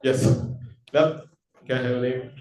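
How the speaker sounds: normal talking voice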